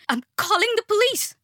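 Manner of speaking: high, frantic yell. A narrator's reading. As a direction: yell the threat at him in panic. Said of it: "she yelled".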